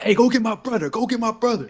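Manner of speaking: high-pitched hollered order; urgent calling out. Retold or imitated. Smoke sends Sam to fetch his brother, urgent high holler.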